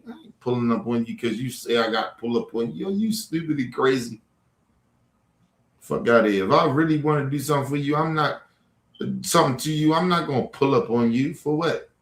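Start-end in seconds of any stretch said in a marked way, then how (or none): none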